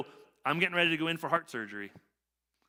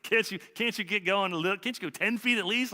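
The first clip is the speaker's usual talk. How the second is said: higher-pitched imitation of an impatient son; mock goading; 5 semitones higher than usual. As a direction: playfully needle a lagging dad. Son goads winded dad uphill.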